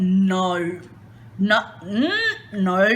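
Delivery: normal talking voice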